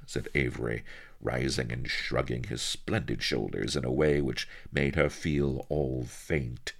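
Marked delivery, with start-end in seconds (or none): none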